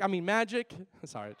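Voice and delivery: ordinary talk, nothing marked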